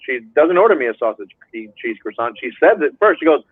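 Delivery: ordinary delivery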